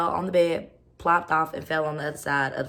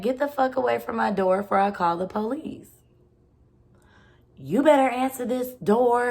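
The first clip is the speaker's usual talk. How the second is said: higher, louder angry threat at the door; retold angry warning; 6 semitones higher, 4 dB louder than usual. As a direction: warn him off from the window with a raised voice. Retold yelling at ex through window.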